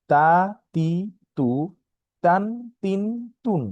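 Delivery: তা, তি, তু, তান, তিন, তুন spoken like Bengali ত; syllable drill. Shown as a demonstration. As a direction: model each form. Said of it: "এটার বিভিন্ন উচ্চারণ ছিল।". (ত এর মতো উচ্চারণ অনুশীলন)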